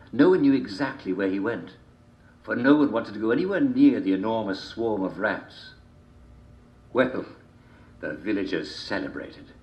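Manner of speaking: plain reading voice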